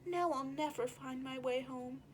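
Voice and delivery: quiet letdown sigh of words; disappointed. Acted. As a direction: give up hope quietly. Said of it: "with disappointment".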